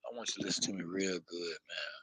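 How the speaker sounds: quiet and fast, leaning into the mic; hushed, intimate urging, quick; close, hushed plea. Real, not acted. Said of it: "I need to get closer".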